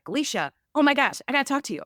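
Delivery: high, loud, rushed alarm, retold; imitating a panicked friend. Friend calling Alicia about money, imitated panic. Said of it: "she called me freaking out".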